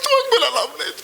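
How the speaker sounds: high, tearful sob of a wife; wife sobbing to her husband about his parents; crying wife. Acted. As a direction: sob it out, hurt and sulking. Wife crying over in-laws.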